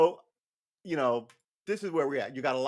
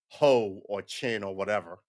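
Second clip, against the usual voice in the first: ordinary talk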